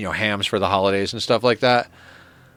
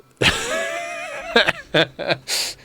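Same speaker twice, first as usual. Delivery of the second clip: burst of laughter. Real, not acted. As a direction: break up laughing hard. Reacting to the punchline.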